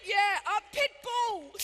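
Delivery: slow, loud retold shout; shouted punchline. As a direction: bellow it across the room. Truck driver shouting the punchline.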